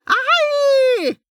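very high, agonised scream; screech of pain. A narrator's reading. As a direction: screech as if badly wounded. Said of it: "a man screeched".

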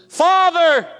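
put-on a girl calling her father from bed; child calling out. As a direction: call out like a frightened little girl in the dark. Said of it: "she called from her bedroom".

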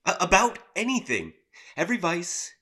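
ordinary talk

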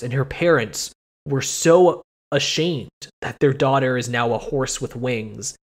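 ordinary talk, nothing marked

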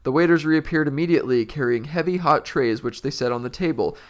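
lively read-aloud with voiced characters; story reading. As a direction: read the book out to a friend. Reads a book aloud with voices.

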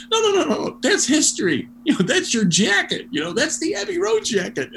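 high-pitched, insistent retold objection; excited protest. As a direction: jump in urgently to correct him. Acting out urgent correction of [name].

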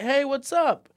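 high, loud friendly Austin greeting; chirpy small-town hello; mock friendly stranger; friendly local greeting. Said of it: "They'll be like".

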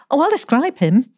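high, bright eager outburst; eager exclamation. Acted. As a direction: jump in with the idea. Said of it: "exclaimed Elsie".

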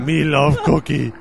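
lightly voiced Cookie Monster; a hint of a greedy cookie monster; Cookie Monster impression. Teasing the soup video with a light Cookie Monster voice. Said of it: "a Muppet".

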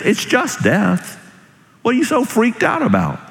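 high-pitched, incredulous challenge; exasperated challenge. Acted. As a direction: push it out high, as if baffled by the fear. First, exasperated take of song's message.

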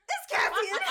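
loud, high excited reveal. Real, not acted. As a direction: shout the surprise twist.

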